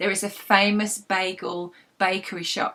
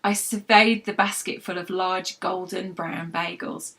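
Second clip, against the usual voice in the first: normal talking voice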